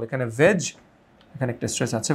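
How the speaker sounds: ordinary talk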